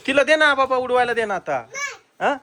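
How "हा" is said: normal talking voice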